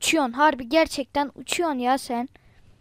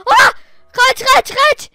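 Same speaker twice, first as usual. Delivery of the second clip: high, loud panicked yelling; panicked shout; 8 semitones higher, 10 dB louder than usual. Real, not acted. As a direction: scream at them to run. Duvar geliyor, panikle bağırıyor. (panikle bağırarak)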